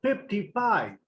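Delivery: "फिफ्टी फ़ाइव" with every F turned to P, "pipti pai"; Bengali P for F. Shown as a demonstration. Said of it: "Bengali speaker की मिसाल दे देता हूँ". ("फिफ्टी फ़ाइव" को बंगाली लहजे में "पिप्टी पाइ" बोलें)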